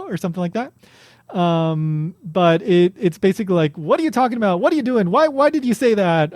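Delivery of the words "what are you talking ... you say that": mock disbelief. Acting out translations of the retort.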